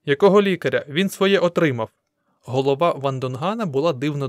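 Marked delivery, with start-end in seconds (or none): 0.0-1.9 louder, higher-pitched rebuttal; dismissive retort. A narrator's reading. Onlooker rejects the call for a doctor, dismissive. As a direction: throw the question back indignantly.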